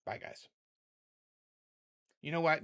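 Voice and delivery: normal talking voice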